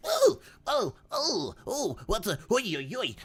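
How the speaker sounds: high, startled little creature voice. Acted. Rowing creature startled by someone in the water, high yelps. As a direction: yelp like a creature caught off guard.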